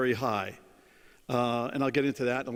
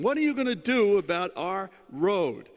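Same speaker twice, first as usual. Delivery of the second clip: retold yell; 5 semitones higher, 3 dB louder than usual. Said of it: "they would yell at him".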